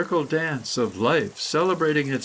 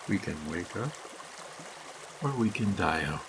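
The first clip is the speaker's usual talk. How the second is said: softer and lower, a quiet echo; hushed echo; 5 semitones lower, 9 dB quieter than usual. Acted. Narrator echoes the choice to listeners, hushed and low. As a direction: let it sink in, barely above a murmur.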